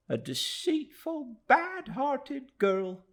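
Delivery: high, slow, biting woman's voice; scornful Mrs Joram. A narrator's reading. Mrs Joram to David, slow high scorn. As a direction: spit it out slowly, high and scornful. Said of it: "cried Missus Joram angrily".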